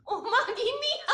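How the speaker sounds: high, loud "ও মা, গিন্নি!"; amused surprise. Real, not acted. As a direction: shriek in delighted surprise.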